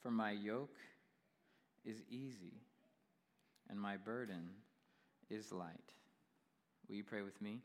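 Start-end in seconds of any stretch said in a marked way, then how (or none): 0.0-5.9 scripture reading. Acted. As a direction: let each phrase settle. Reads scripture slowly.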